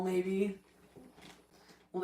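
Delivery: ordinary delivery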